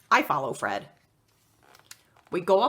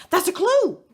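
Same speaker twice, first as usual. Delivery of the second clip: excited; 12 semitones higher, 6 dB louder than usual. Acted. Excited discovery of clue.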